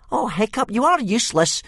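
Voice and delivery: high, loud crowing jeer; crowing bully mocks Hiccup, high and loud. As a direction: gloat over him, pitched high and loud. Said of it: "crowed Speedy Fist".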